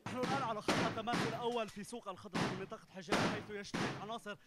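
high, tense front-line report; war correspondent. Real, not acted. Reporter speaking live at front line.

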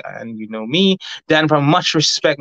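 ordinary delivery

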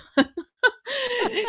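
high burst of laughter; amused laugh. Real, not acted. Coach amused by her Thatcher demonstration, laughing. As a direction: laugh at your own demonstration.

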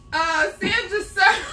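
high laughing cry. Real, not acted. Wife reads a viewer's name while laughing hard. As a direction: crack up mid name.

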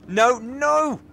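high, loud cry of no; dismayed shout. Real, not acted. Game move goes wrong.

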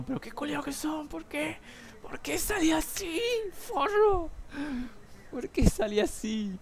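high, strained cursing at the team; retold cursing at his team. While his team loses, he curses them in a high, strained voice. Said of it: "yo estaba puteando".

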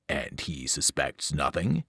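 a buried titan probing his spy; evil pit monster. Acted.

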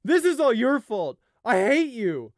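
very high, very loud, furious scream; full-lung scream. A narrator's reading. Grieving brother blames the sky, screaming. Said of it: "he screamed at the top of his lungs".

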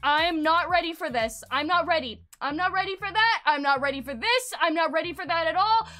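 loud, insistent, repeated protest; emphatic refusal. Retold or imitated. Girl telling boyfriend she isn't ready, emphatic refusal. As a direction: push each repetition out firmly.

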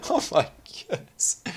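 whispered, high with relief; breathless whisper. Real, not acted. Gamer just escaped, whispering relief. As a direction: breathe it out, amazed.

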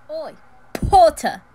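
loud, high sneering Oi Potter; Malfoy's taunting shout; rude shout. Acted. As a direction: call him out across the hall. Malfoy calling out rudely.

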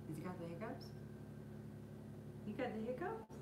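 hushed doting pet talk. Real, not acted. Puppy has hiccups, cooing.

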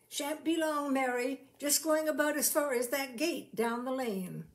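a hint of a doting owner saying goodbye; lightly voiced Fred. A narrator's reading. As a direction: call back gently over the shoulder. Fred calls goodbye to the pig.

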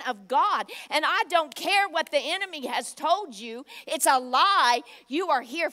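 loud, building exhortation; preaching at full force. Acted. Preacher rallying the congregation against the enemy's lie, loud.

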